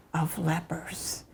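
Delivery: final words breathed out in a whisper; whispered ending. Acted. Poet closing her poem, whispering the final refrain. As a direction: let the last words fade into a whisper.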